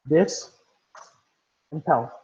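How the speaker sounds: "This", pause, "pel", each part set apart; syllable by syllable. Shown as a demonstration. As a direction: break the word into "This" and "pel" with a long gap. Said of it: "How many syllables does the word dispel have".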